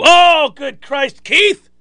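slow, high, exasperated groan of a name; exasperated outburst. Pretend. Referee hearing a bad crewmate is assigned, exasperated. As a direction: throw up your hands at the news. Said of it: "I'd be really angry with it".

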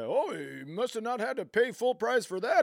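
high-pitched, put-on cranky old man; complaining old man. Old man carping about a young woman's clothes, complaining. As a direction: gripe like an exaggerated old man. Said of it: "I sound like an old man complaining".

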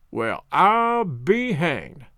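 drawn-out, solemn amazement; slow and solemn. A narrator's reading. Man recognising a dog, slow and solemn. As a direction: say it very slowly and solemnly. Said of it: "slowly and solemnly repeated".

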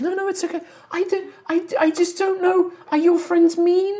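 high, hesitant worried voice; high-pitched timid creature; tree woman. Acted. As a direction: fret in a high put-on voice.

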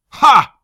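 loud scoffing ha; mock him with a sharp laugh; scornful bark of a laugh. Rusk scoffs loudly.

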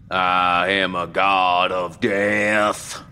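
slow, loud, theatrical vampire lord; grand vampire. A narrator's reading. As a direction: sneer it like a stage Dracula. Vampire declares himself a god.

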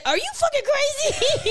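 very high-pitched, laughing disbelief; mock incredulous outburst. Zach reacting to the refused money, mock outraged disbelief. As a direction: imitate an appalled partner, voice way up.

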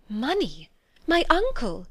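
bewildered questions, climbing high; rising disbelief; astonished cry. A narrator's reading. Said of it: "cried Jane".

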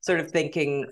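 ordinary talk, nothing marked

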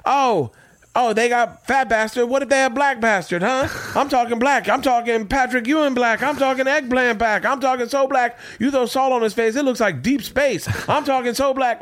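high-pitched, rolling riff of throwaway jokes; Ralphie stand-up impression. Podcaster mimics late comedian's rapid throwaway lines, high stand-up voice. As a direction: hammer out joke after joke, pitched well up. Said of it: "he throws them out in such a way".

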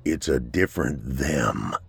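low, slow, fearful murmur. Acted. The sergeant tells his captain of new creatures, fearfully. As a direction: let the dread sink in.

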